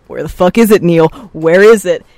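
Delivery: loud frustrated yell; shouting. Retold or imitated. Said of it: "We're like".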